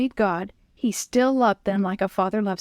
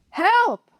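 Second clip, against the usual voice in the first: high desperate shout; shouting for help; 11 semitones higher, 4 dB louder than usual. A narrator's reading. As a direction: yell for rescue. Calling for rescue.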